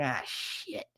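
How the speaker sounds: voiceless, very soft swear; hushed cursing. Retold or imitated. Ex pops his head out, hushed dismay. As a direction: curse under your breath. Retold reaction to being spotted.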